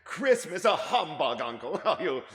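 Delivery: high, loud laughing disbelief. Acted. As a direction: laugh it off in good humour. Nephew laughs at uncle.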